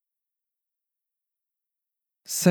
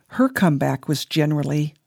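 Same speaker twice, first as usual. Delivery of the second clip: ordinary delivery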